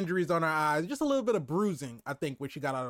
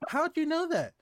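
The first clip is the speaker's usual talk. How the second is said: high surprised question; 11 semitones higher than usual. Retold or imitated. Voicing the kids, surprised.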